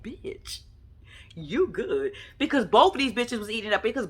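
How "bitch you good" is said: hushed, whispered retort; whispered aside. Retold or imitated. Retold stunned remark, whispered.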